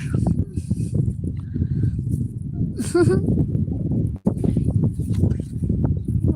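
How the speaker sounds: breathy high-pitched giggling; high giggle. Real, not acted. Laughing after cold joke.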